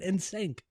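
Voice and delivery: mock "sync" with an a sound; mimicked mispronunciation. Telling cohost to stop saying sank, mimicking. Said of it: "Well, stop saying".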